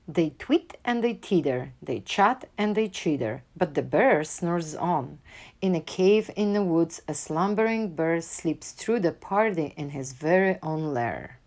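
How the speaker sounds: ordinary delivery, nothing marked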